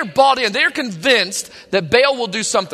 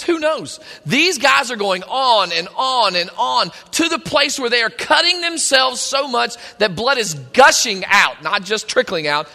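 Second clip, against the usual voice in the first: ordinary delivery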